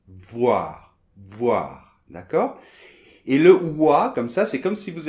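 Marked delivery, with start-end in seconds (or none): 0.1-2.0 "voir" from F-shaped lips opening to wa, a throaty R at the end; French voir. Shown as a demonstration. Teacher demonstrating the syllable voir. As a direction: start on the V and open into wa. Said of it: "mais ça va s'ouvrir".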